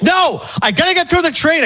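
high-pitched indignant refusal; incensed. Retold or imitated. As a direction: imitate an irate man refusing to budge. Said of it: "and a guy's incensed".

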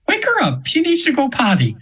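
very high channelled voice, falling at the end; imitated angel voice. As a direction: mimic the angel in a squeaky-high pitch. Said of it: "I'm almost using her voice now".

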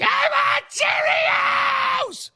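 put-on high, slow crying toddler scream; toddler tantrum wail. Parent imitating a toddler's grocery store tantrum, wailing. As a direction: wail it like a kid flat on the floor. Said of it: "they go spread eagle on the floor and they're like".

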